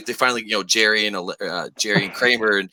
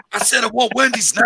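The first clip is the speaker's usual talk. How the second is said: mock commander; 9 semitones higher than usual. Continuing the Duvall bit.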